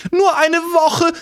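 fast, high, begging crone voice; pleading old woman. Acted. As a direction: rattle off pleas like a panicked old lady.

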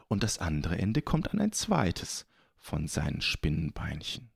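usual speaking voice

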